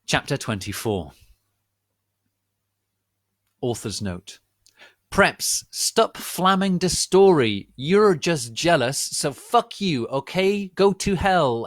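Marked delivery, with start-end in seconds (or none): 5.1-11.7 high, loud, slow furious rant; angry rant. Acted. Author yelling at the preps flaming her story. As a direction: yell it at the haters.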